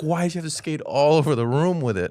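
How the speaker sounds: high-pitched mock exasperation; playful retold parental exasperation; exasperated retold complaint.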